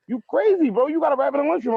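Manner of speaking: high-pitched quote of a stunned friend; imitated amazement. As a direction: mimic a buddy who can't believe it. Retells friend's amazed reaction.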